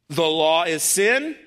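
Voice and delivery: slow, incredulous rise to "sin"; sarcastic question. Acted. Preacher voicing Paul's absurd question, slow and sarcastic. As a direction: drag it out, lifting "sin" into a mocking question. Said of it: "I know he's being sarcastic".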